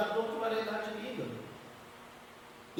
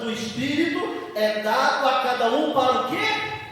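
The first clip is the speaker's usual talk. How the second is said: loud, high preaching with stretched key words; forceful preaching; 3 semitones higher, 7 dB louder, slower than usual. Acted. Preacher explaining what gifts are for, forceful preaching. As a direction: build to rhetorical questions for the congregation.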